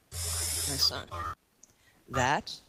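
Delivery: gentle elder's answer; wise elder. Acted.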